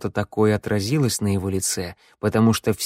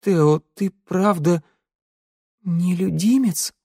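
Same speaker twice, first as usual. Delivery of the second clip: higher-pitched, slow plaintive question; tearful; 8 semitones higher, slower than usual. A narrator's reading. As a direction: ask it slowly, close to tears. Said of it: "продолжила Санда плачущим голосом". (плачущим голосом)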